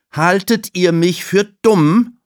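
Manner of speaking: high, loud stern challenge; Tulile calling out. Acted. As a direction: scold the two kids sharply. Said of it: "ruft sie".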